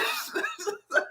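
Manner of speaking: high breathless squeal of laughter. Real, not acted. Laughing at her own reaction, high squealing laugh. Laughing after retold shock.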